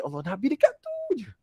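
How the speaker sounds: very high, hushed-back wonder; awed realisation. Retold or imitated. Preacher reliving his thought near the Prophet's tomb, high awed wonder. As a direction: exclaim a marvelling thought up high. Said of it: "saya pun terus fikir".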